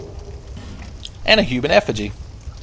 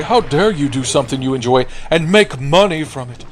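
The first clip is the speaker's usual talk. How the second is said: a hint of a whiny indignant critic, higher; mocking outrage; 5 semitones higher than usual. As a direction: parody an outraged online commenter. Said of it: "They're like".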